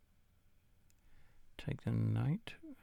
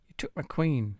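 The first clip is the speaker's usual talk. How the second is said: louder, higher exclamation of dismay; dismayed surprise; 4 semitones higher, 8 dB louder than usual. Real, not acted. Chess player losing his queen, surprised outburst. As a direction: blurt it out, caught off guard.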